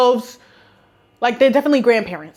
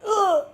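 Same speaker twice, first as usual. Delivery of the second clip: high-pitched "ugh" of disgust; disgusted groan; 9 semitones higher, 3 dB quieter than usual. Real, not acted. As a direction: recoil with a short grossed-out noise. Disgust after acted giggle.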